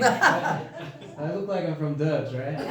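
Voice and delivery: slow, laughing through the words. Real, not acted. Joking to the crowd about his looks, laughing. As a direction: chuckle it out, slowly.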